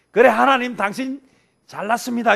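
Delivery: loud sarcastic "fine, you're so great"; sarcastic jab at God. Retold or imitated. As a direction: spit out 잘났습니다 like a sneering retort. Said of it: "이건 시비 거는 거지".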